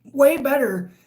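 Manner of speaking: talking through hiccups; hiccuping between words. Real, not acted. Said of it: "sorry about my hiccups".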